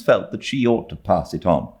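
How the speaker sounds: ordinary delivery, nothing marked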